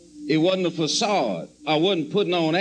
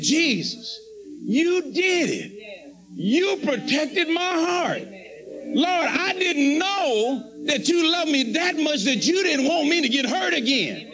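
overjoyed thanks; 7 semitones higher than usual. Retold or imitated. Retelling his grateful prayer, thanking Jesus in high amazed joy. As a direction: marvel that God protected you. Joyful thanks after heartbreak averted.

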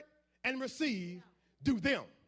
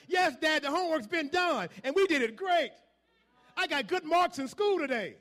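put-on higher, louder eager child; kid's bright answer to dad, pitched up; 4 semitones higher, 4 dB louder than usual. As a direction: play the proud kid reporting good marks. Kids answering father.

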